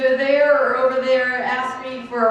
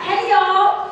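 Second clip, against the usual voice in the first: higher, fuller hey y'all for the back rows; bigger greeting call; 6 semitones higher than usual. Shown as a demonstration. Speaker urging the crowd to answer, bigger call. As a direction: reach the people at the back. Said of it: "I'm not sure I heard the back".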